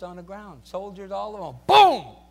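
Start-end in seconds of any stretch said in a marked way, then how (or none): 1.7-2.2 loud, high boom; shouted boom. Retold or imitated. Preacher showing soldiers hitting the ground, shouted. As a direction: slam it out like a crash.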